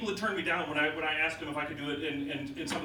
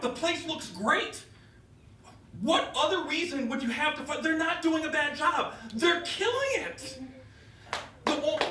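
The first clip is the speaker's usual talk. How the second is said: impassioned plea; 8 semitones higher than usual. Real, not acted. Passionate defense continues, higher pitch.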